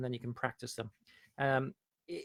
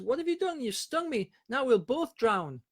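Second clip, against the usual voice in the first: much higher, stricken, slowing on the last words; the betrayed frog realising it will drown; frog's panicked cry; 9 semitones higher, 5 dB louder than usual. Acted. Fable frog to scorpion, high panicked cry. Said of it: "And the frog says".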